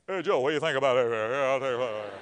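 put-on low-pitched men fussing over a baby; mock deacons. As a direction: rumble it low like old deacons chatting. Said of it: "have you ever seen deacons".

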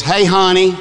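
a hint of a Southern waitress; sweet "Hey, honey" from the waitress; waitress greeting. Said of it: "Lady goes".

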